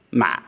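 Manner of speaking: "ma" dropped short with a tightened throat; heavy constricted tone. Shown as a demonstration. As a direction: say "ma" low, heavy and cut off. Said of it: "or constricted tone".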